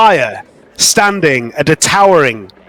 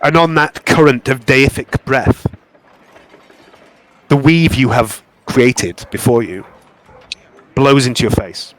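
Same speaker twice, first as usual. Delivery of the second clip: game master narration; 4 semitones lower than usual. A narrator's reading. Game master describing a goddess vision, hushed narration.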